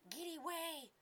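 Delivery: very high, squealing monkey voice; screeching little monkey. Acted. Trapped little monkey screeches at Artius to stay away. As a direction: squeal at him in fright. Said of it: "it screeched at Artius".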